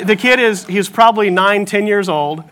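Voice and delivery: ordinary talk, nothing marked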